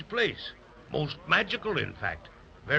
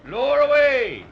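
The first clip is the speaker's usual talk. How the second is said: calling out an order; 11 semitones higher, 10 dB louder than usual. Acted. Captain to crew lowering the rowboat, calling out. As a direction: holler it to the deck crew.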